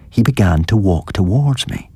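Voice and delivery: usual speaking voice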